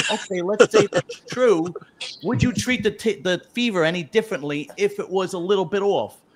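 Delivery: mock reasoning kid. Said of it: "And I was like".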